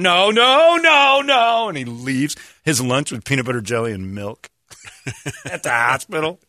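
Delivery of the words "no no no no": high, loud squealing no's; hammed-up melodramatic protest; mock protest. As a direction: shriek the denial like a soap-opera hero. Mocking a TV doctor's protest.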